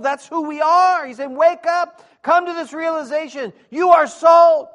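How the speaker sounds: high, shouted sermon climax; full-force preaching. Real, not acted. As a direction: preach it loud and high, hammering key words.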